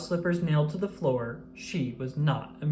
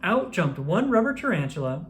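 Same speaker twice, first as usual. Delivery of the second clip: ordinary delivery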